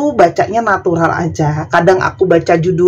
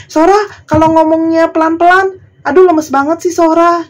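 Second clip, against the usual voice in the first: high whiny mock complaint; mocking complainer; 9 semitones higher than usual. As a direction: nag like a fussy viewer. (meniru keluhan penonton dengan nada tinggi)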